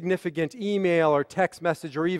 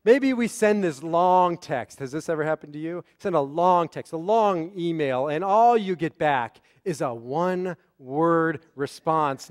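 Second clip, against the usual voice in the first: ordinary talk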